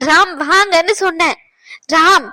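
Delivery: a wife raising her voice to pull her husband from the bedside; firm call. Acted. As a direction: order him out now. Wife firmly calling husband away.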